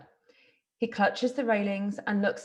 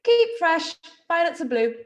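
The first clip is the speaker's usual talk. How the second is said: carousel caller; 7 semitones higher, 6 dB louder than usual. Acted. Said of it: "The voice of a fairground barker".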